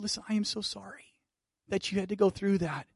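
soft, hushed sympathy; tender. Retold or imitated. Comforting the crying woman in the barbershop, softly.

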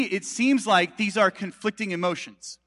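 normal talking voice

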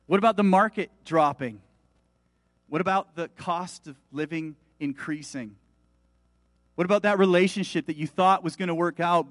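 usual speaking voice

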